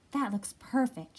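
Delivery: a touch of mouse squeak; squeaked delight. A narrator's reading. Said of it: "she squeaked".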